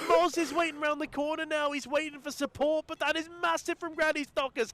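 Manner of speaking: high, loud esports casting; hyped play-by-play. Real, not acted. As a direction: call the action at full hype.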